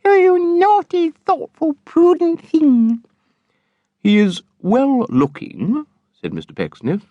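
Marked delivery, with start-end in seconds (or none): 0.0-3.0 put-on very high, loud teasing girl's voice; giggling teasing girl. A narrator's reading. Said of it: "with the most winning giggle". 4.0-5.9 slow, deliberate, higher father's voice; slowly and distinctly. A narrator's reading. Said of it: "slowly and distinctly".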